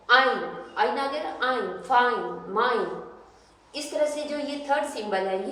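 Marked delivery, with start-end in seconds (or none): none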